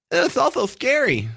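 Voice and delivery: high, hammed-up mimicry of a pundit; mock the line in a high, campy lilt; flamboyant voice. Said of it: "that's me doing my flamboyant voice".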